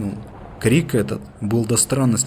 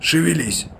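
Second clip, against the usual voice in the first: higher-pitched hurrying call; urging order, pitched up; 7 semitones higher than usual. A narrator's reading. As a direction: hurry the men along. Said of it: "поторопил второй помощник".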